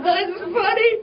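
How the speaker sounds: high-pitched imitation of helpless laughter; imitating dad laughing through his words; dying laughing. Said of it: "He's dying laughing".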